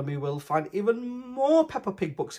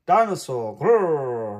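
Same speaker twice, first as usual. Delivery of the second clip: loud playful growl; mock dinosaur roar; 6 dB louder, slower than usual. A narrator's reading. Child playing dinosaur, loud growling roar. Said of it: "roars George".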